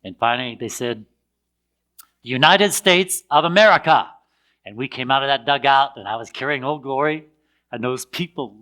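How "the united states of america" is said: a lightly voiced stadium announcer, louder; mock announcer call. Announcer at the games calls out the American team, raised announcer call.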